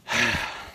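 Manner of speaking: long exhausted exhale; winded sigh. Real, not acted. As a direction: let out a breath after exertion. Catching breath after intense effort.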